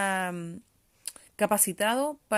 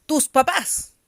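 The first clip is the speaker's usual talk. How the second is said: sharp, loud ¡Tus papás! with a higher pitch; annoyed exclamation; 5 semitones higher, 9 dB louder, slower than usual. Shown as a demonstration. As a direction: bark it like an angry mother. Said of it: "es como cuando estuviera enojada". (exclamación seca y fuerte, como enojada)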